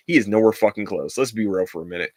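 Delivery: usual speaking voice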